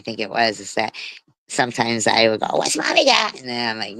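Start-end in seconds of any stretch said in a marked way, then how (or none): 2.6-3.4 very high coaxing pet voice; sing-song dog call. Retold or imitated. Owner teasing her dog with a treat call, very high and sing-song. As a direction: lilt it way up like calling a dog.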